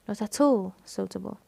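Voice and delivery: "all" leaned on before "suitable"; stress on ALL. Shown as a demonstration. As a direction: make "all" the long, prominent word. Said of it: "المقطع all". (التشديد على "all")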